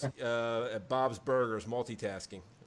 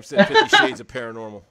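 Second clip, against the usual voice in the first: laughing; 3 semitones lower, 9 dB louder than usual. Real, not acted. Reading funny chat comment.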